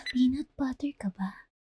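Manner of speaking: unvoiced whisper, teasing; pickup line whisper. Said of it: "A S M R". (pabulong na banat)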